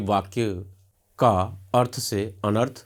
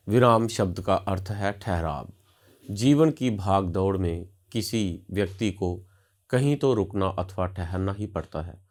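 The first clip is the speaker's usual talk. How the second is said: normal talking voice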